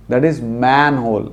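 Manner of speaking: manhole said slowly with a clear man; slow correct demo. Shown as a demonstration. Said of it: "वो मेन होल नहीं होता". (manhole धीरे, साफ़ मैन के साथ)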